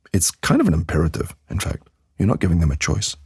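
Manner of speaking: ordinary talk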